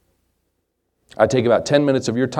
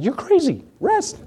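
much higher, disbelieving cry of rest; incredulous wife; slower than usual. Retold or imitated. Wife refusing to rest with a rat in the room, high incredulous. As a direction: squeak out the disbelief.

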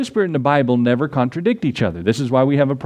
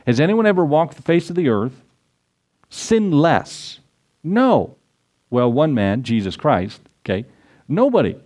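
usual speaking voice

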